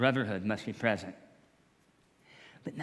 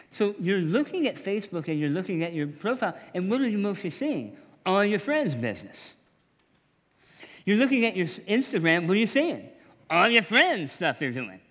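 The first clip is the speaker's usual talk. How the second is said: animated preaching; 7 semitones higher, 7 dB louder than usual. Real, not acted. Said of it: "animated preaching".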